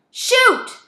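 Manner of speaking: very high, very loud shriek; screamed urging. A narrator's reading. Voice in Zach's head urging gunfire, screaming. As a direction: scream the word out.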